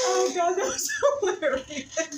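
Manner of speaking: high, breathless laughter; laughing hard. Real, not acted. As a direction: crack up mid-sentence. Laughing fit after joke.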